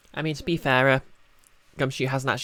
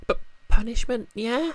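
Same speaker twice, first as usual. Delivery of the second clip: catgirl voice; 9 semitones higher than usual. Acted. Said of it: "give, uh, Gumshoe a Catgirl voice".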